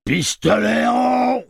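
slow, high, huge booming call. Acted. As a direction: boom it out like thunder from nowhere. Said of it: "tonna une voix surgie de nulle part".

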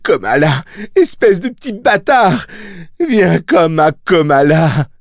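high, straining shout; howling. A narrator's reading. Mia screams at her baby while pushing, howling. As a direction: yell high as you push with all your force. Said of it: "hurla-t-elle".

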